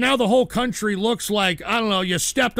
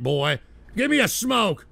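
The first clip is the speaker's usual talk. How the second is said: usual speaking voice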